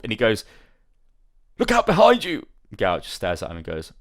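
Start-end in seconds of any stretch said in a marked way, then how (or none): 1.6-2.5 high, loud fake alarm; hammed-up cry of warning; mock warning shout. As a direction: yell it as a sudden diversion. Merchant's trick warning, acted.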